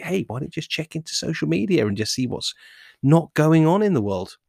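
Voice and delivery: high-pitched, insistent pleading to stop; nagging inner voice. Acted. Inner voice talking the athlete out of training, high and nagging. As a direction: coax and pester in a high, repetitive voice. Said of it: "that repetitive, high frequency, powerful voice".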